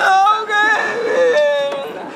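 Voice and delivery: very high, drawn-out coo; flirty delight. Acted. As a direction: stretch it out high, smitten. Boss pleased, flirting.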